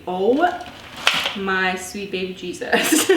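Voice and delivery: usual speaking voice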